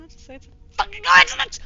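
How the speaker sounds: fast exasperated shout; mock yell. Mock yelling at self.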